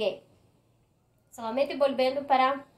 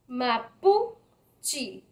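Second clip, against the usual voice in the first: "Mapuche" split into separate syllables. Shown as a demonstration. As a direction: sound out "Mapuche" with gaps between syllables. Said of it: "ahí la sílaba más".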